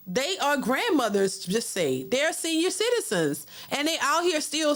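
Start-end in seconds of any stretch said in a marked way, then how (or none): none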